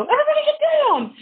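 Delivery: high mock shout of alarm; joking yell. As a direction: yell it as a joke, pitch way up.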